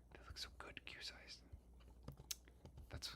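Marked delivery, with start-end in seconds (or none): 0.1-1.4 whispered aside. Real, not acted. Muttering to himself.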